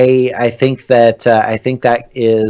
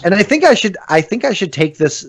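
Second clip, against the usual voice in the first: ordinary delivery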